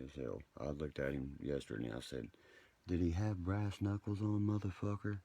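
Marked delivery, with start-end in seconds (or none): 2.9-5.2 loud, higher demand; angry shout. Retold or imitated. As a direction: yell the accusation at him. Confronting him about brass knuckles.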